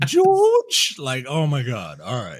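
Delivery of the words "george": high rising posh English "George?"; English accent. Retold or imitated. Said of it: "He pronounces George in an English accent".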